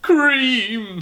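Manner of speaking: bitter high cry; bitterly, pitched high. Acted. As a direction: spit out Cream in disgust. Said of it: "he said bitterly".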